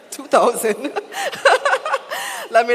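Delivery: words through laughter; can't stop laughing. Real, not acted. Emcee cracking up at his own joke.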